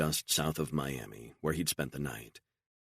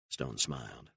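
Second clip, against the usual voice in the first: plain reading voice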